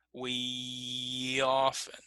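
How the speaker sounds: WE OFTEN joined by a long, drawn-out y glide; linked glide. Shown as a demonstration. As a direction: stretch the glide from "We" into "often", very slowly. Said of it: "use one of these glide sounds".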